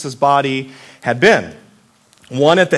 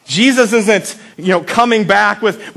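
usual speaking voice

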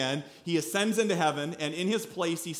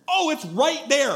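excited spotting; 8 semitones higher, 6 dB louder, slower than usual. Retold or imitated.

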